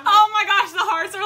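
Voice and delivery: high and loud oh my gosh; excited squeal. Real, not acted. Host reacting to a flood of viewer hearts, excited.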